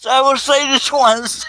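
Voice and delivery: high, slow, loud laugh-choked threat; mock warning squealed through laughter; losing it laughing. Real, not acted. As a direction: shout it slowly while cracking up. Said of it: "[name]'s losing it".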